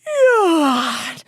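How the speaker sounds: a hammed-up spoken yawn; mock yawn. Host acting out waking up, mock yawn.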